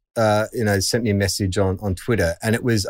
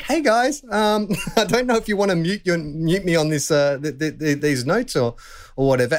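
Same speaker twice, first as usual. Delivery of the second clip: mock sheepish; 8 semitones higher than usual. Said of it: "I was like".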